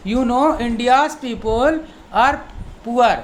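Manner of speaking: very slow, careful teacher reading; slow model sentence. Shown as a demonstration. Teacher reads the example sentence slowly to students. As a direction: spell it out word by word. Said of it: "दूसरे हिसाब से बोल सकते हैं".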